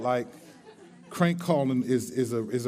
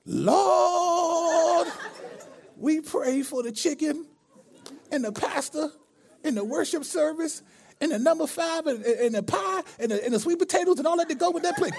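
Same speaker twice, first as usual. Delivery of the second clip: much higher church-lady prayer, half laughing; old lady praying; 13 semitones higher than usual. Retold or imitated. Prank caller praying for cashier, old lady voice. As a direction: pray earnestly over the chicken order. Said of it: "the voice went".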